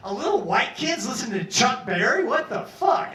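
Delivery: high, loud disbelief; can't believe it; amazed. Retold or imitated. Inmate amazed at the taste.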